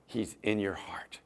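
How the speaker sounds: soft, whispered comfort; whispered reassurance. Real, not acted. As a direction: whisper it like a secret blessing. Tender close of the sermon point.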